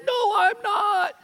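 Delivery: put-on high, slow tearful child; scared refusal. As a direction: refuse through fear, almost crying. Scared boy refusing, voiced.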